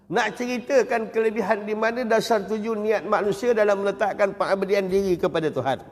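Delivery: ordinary talk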